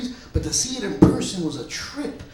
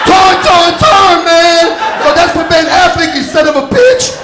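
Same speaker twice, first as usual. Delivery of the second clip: louder, higher mock-furious yelling; furious shout; 9 semitones higher, 14 dB louder than usual. Punching a stranger at the memorial, a hammed-up furious shout. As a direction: scream it like you lost it. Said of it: "I said".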